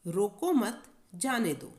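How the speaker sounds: रोको मत run together, then a break before जाने दो; pause after मत. Shown as a demonstration. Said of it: "ना रोकने का". (मत के बाद विराम)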